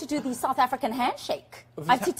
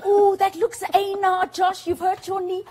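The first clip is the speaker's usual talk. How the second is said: higher, wincing sympathy for a hurt knee; sympathetic wince; 8 semitones higher, 3 dB louder than usual. Shown as a demonstration. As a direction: feel the pain for a friend. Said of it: "if you're in pain".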